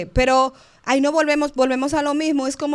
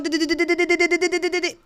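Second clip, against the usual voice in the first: rapid babbling syllables, pitched higher; mock nonstop chatter; 5 semitones higher, faster than usual. Someone praying without pausing for God, mock chattering monologue. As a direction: rattle on like someone who never lets anyone else talk. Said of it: "No, un monólogo".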